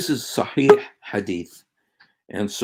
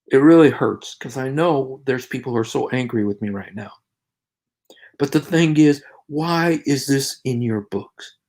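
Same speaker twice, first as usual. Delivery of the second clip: usual speaking voice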